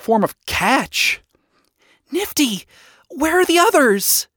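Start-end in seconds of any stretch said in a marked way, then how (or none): 2.1-4.3 high, urgent questioning; panicked question. A narrator's reading. Charlie asking Nifty about the others, in a panic. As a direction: ask in a panic. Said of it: "Charlie asked her in a panic".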